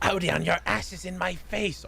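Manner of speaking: lightly voiced complaint to his servant; mock Marik. Marik complains to Odion, lightly annoyed.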